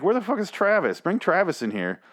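high, fed-up demand, dropping at the end; exasperated demand. Retold or imitated. Viewer demands the missing hero, high exasperated call. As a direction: insist they bring Travis in.

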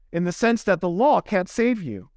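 ordinary delivery

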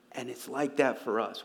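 ordinary delivery

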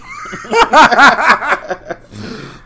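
high burst of laughter; loud laugh. Real, not acted. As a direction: let it rip laughing. Laughing at own quote.

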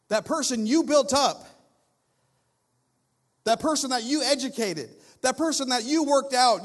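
loud, high, forceful preaching; impassioned preaching. Real, not acted. Preacher confronts congregation about self-built pride, impassioned. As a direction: press each phrase home at full force.

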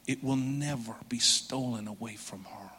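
breathy whispered promise. Acted. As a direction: lean in and whisper it. Hushed climax of quoted promise.

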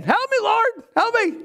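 high, panicked plea for help; desperate cry. Acted. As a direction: cry out like you're going under. Sinking believer crying out.

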